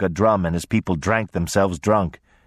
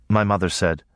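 ordinary delivery

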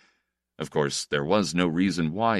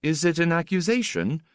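higher-pitched, innocent asking; naive question; 10 semitones higher than usual. A narrator's reading. Young guest asks his teasing host, naively. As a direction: ask it naively, in a raised voice. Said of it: "Itale asked naively".

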